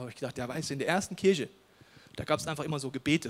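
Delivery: ordinary delivery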